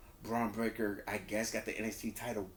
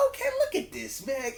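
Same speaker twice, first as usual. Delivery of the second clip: high voice, laughing through it; laughing disbelief; 12 semitones higher, 4 dB louder than usual. Real, not acted. Fan laughs in disbelief at ref's fast count. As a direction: laugh at the nonsense. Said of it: "laughing reaction".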